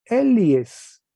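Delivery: "Elies" high, loud, falling; teacher modelling the form; sounded out. Shown as a demonstration. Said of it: "Y será".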